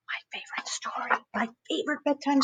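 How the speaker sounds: eager hushed whisper. Real, not acted. Storyteller whispering the title with excitement. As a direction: breathe it like a treat. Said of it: "excited whispered intro".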